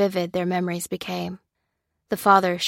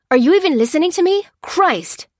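much higher, loud, fast yell; furious shout; 10 semitones higher, 8 dB louder than usual. A narrator's reading. Lopez yelling at distracted Lucy, furious fast shout. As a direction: explode at her. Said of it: "Lopez was shouting at her".

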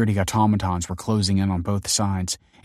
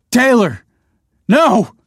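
very high, loud horrified scream; 10 dB louder, slower than usual. A narrator's reading. Man as friend bounds to bomb, desperate scream. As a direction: scream to stop someone heading into danger. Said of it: "he screamed".

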